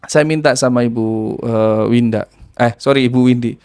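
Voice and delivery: ordinary delivery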